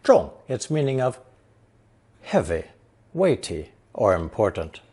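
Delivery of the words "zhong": ZHONG in a sharply falling fourth tone. Shown as a demonstration. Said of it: "gives fourth tone".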